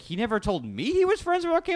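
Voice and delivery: very high retold disbelief; astonished. Speaker reacts to wife's news, astonished re-enactment. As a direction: squeal it as if personally left out. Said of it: "And I was like".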